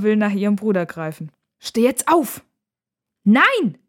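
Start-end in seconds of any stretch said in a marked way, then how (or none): 1.6-2.4 louder, higher-pitched command; raised, impatient sisterly demand; urgent order. A narrator's reading. 3.2-3.8 put-on high, loud childish yell; explosive, high-pitched protest; defiant shout. A narrator's reading. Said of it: "schreit Max".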